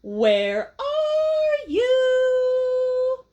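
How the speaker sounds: high drawn-out singsong shout; loud shout. Acted. Max shouts up to the hidden moon, long and sung. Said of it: "so he shouts really loud".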